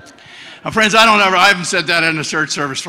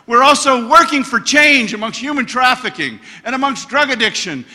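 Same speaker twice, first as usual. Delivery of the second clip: full-force preaching; 3 semitones higher than usual. Acted. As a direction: drive the good news home to the congregation.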